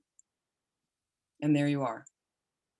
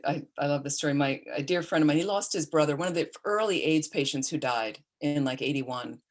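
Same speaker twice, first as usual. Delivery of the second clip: ordinary talk, nothing marked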